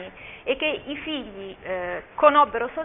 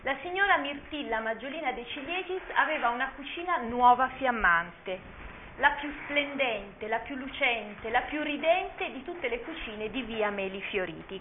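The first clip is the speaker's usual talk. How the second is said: storybook reading; 5 dB quieter than usual. Acted. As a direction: savour each phrase like a bedtime story. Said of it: "l'incipit è proprio questo".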